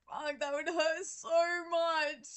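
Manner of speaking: slow, high, laughing pained voice; mock wince. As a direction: pretend to feel the hurt, cracking up. Host imagines boyfriend's pain.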